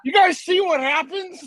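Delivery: imitating a shop teacher, laughing; high, loud mock teacher warning; shop class teacher. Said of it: "my old shop teacher who'd be like".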